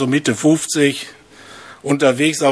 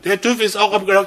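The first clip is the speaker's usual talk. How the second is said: higher and quicker, calling out to her; raised, quick call; 5 semitones higher, faster than usual. Retold or imitated. Husband repeats it to his hard-of-hearing wife, higher and hurried. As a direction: call it to someone who can't hear well, briskly. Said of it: "sagt der Mann".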